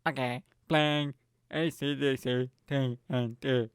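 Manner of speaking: slow, mock robotic assistant voice; Alexa. Smart speaker confirming a song request, robotic impression. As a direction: imitate a machine reading out letters, slowly. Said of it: "this fucking thing went".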